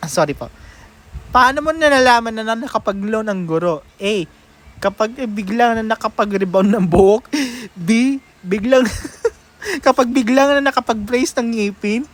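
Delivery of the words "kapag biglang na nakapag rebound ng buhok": ordinary talk, nothing marked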